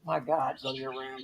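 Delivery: low, quiet demonic growl; deep creepy demon voice. Pretend. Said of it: "That's my demon voice".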